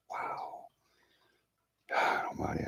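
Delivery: real whispered shock, almost no voice; stunned whisper. Real, not acted. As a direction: breathe it out in disbelief. Shocked by news of surgery.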